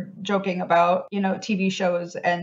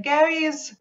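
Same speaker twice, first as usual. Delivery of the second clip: a hint of old-time mid-Atlantic, pitched up; mid-Atlantic accent; 8 semitones higher than usual. Sibling imitates sister naming her ghost, mid-Atlantic accent. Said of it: "mid-Atlantic, mid-century accent thing".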